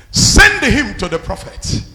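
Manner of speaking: booming prophet's command; shouted command. Acted. Prophet commanding that the man be sent, booming command. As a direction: bellow the order.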